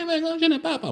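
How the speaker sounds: voix bien plus aiguë, imitation moqueuse de la sœur. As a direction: singe la sœur qui refuse, en fausset. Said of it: "Elle dit". (la sœur refuse de partir, imitée en voix de fausset)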